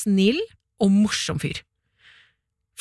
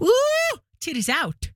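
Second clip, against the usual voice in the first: high squeal; 13 semitones higher, slower than usual. Retold or imitated. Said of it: "der jeg liksom".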